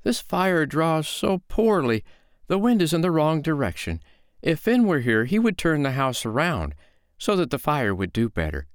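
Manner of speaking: high, plaintive woman's voice; lilting storybook complaint; complaining foster mother. Acted. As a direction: fret aloud, pitched well up. Foster mother complains, baiting giant.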